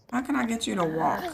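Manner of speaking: usual speaking voice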